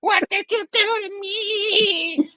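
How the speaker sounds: high, drawn-out villain wail; Goblin. Acted. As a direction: wail slowly in squeaky disbelief. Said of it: "He's like".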